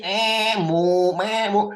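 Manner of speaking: mock bleating and mooing; mock animal bleats. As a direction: bleat like a goat, then moo. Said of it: "sama lembu bisa bunyi". (tiruan embik dan lenguh)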